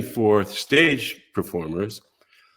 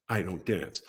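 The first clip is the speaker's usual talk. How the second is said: quiet admission; 7 semitones lower, 11 dB quieter than usual. Retold or imitated. Aside admitting he can't dance.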